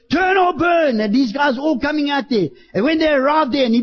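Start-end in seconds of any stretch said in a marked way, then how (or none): 0.1-1.0 high, ringing cry of warning; prophet's shout. Retold or imitated. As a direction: call it out to the crowd. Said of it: "John the Baptist shouting out there".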